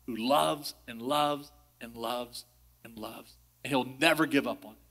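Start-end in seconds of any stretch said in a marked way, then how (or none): 0.1-3.4 tender emphasis. Acted. Preaching God's endless love.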